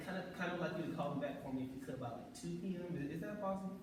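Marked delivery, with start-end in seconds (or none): 3.2-3.7 POSSIBLE asked with a higher, lighter tune; upbeat asking inflection. Shown as a demonstration. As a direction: lift "is that possible" into a friendly request. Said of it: "the inflection in my voice".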